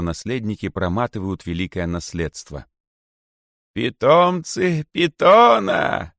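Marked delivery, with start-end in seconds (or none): 3.7-6.1 high, loud, slow and piercing. A narrator's reading. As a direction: pierce through the hiss. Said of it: "пронзительным голосом". (пронзительным высоким голосом)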